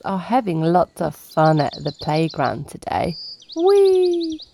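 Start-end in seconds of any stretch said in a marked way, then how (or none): 3.6-4.4 high, delighted squeal; gleeful whee. Acted. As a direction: let it fly like a kid on a ride. Playground fun on the roundabout.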